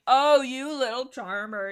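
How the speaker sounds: slow, loud, swooning gush; drawn-out gush over a flirt; mock swoon. Real, not acted. Fan swooning loudly over Firestar's sweet talk.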